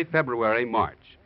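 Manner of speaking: usual speaking voice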